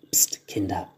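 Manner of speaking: low, whispered shush; hushing. A narrator's reading. Mother quieting the nagging children, hushed. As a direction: hush the children under your breath.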